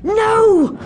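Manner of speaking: high, loud cry of rage; a sudden cry of thwarted rage; furious cry. Acted. Villain seeing the Doctor stop Zoe, loud cry.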